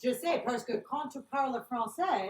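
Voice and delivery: sing-song put-on French; playful French accent; foreign accent in French. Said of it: "speaks Bilang".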